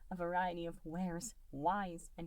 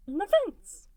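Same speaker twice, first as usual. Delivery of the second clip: much higher, louder nervous little voice; nervous smile; 16 dB louder than usual. A narrator's reading. Goo nervously telling Mike where Taco is. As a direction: blurt it out with a nervous grin. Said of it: "with a nervous smile".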